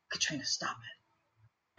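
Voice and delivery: slow, voiceless imitated reprimand; hiss-free whisper, telling her off; whispered scolding. Casting director to squatting actress, whispered reprimand.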